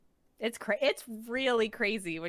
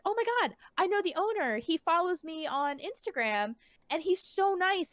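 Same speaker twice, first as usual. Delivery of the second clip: excited gush; 7 semitones higher than usual. Retold or imitated. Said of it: "And I had been like".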